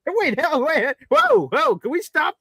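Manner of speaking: high, flustered string of questions; high-pitched bewildered moviegoer asking to stop the film; mock confusion. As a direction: play the bewildered moviegoer begging to pause. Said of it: "I'm like".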